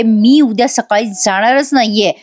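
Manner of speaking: ordinary talk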